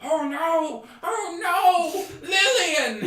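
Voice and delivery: very high, loud panicked goblin voice; frantic high-pitched goblin wail; yelling goblin. Acted. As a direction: shriek across to the next stall. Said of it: "Like, yelling at the other stall".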